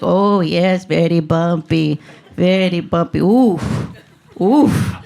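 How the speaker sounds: put-on examining doctor with a robotic foreign accent; slow ESL-accented pronouncement, then little oofs; cute ESL-robot accent. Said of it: "sounded like a cute little robot took E S L classes".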